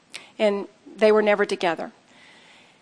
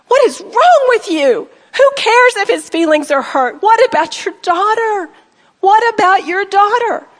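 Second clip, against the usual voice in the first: furious shout; 10 semitones higher, 6 dB louder than usual. Retold or imitated. Mother yelling at her daughter about her grandchild, furious. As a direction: shout at her with years of hurt boiling over. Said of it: "Do you see the anger?".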